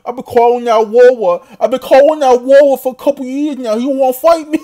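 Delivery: put-on high, loud babyish callout with W's for R's; mock lisp. Imitating the boxer calling out his rival, loud babyish lisp. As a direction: exaggerate the lisp, pitched well up. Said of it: "have a lisp".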